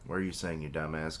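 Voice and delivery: plain reading voice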